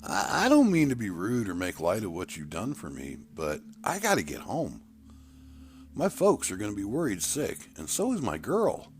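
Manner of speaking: put-on a scared boy pushing back politely; nervous plea. Scared boy insists on home.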